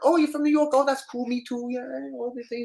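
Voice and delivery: casting guy interviewing the actor, high and smarmy; sleazy casting guy. Retold or imitated. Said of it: "You know".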